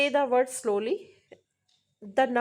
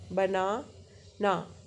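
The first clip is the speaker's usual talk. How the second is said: बनाना broken as बना, pause, ना; two jaw drops; 3 semitones lower, much slower than usual. Shown as a demonstration. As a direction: say banana very slowly in two chunks. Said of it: "दो बार drop हो रही है".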